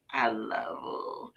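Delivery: put-on soft, slow, drawn-out little-kid love you; hushed, lingering imitation of a little boy; little boy Doobie. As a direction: stretch it out quietly, like a small child. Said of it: "That's how Doobie used to say it".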